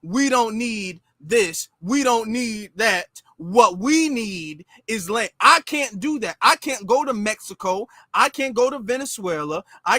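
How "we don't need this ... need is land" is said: drawn-out, deliberate mock speech, barely changed; slow mock quote. Mocking someone else's speech, slow and deliberate. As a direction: lay each word down slowly, lightly mocking.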